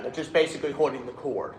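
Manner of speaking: usual speaking voice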